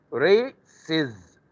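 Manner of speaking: "races" slowed right down, ending on a clear extra syllable IZ. Shown as a demonstration. Teacher modelling a plural slowly for students, "races" with IZ. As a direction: stretch "races" so the IZ ending stands out. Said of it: "it will be I Z".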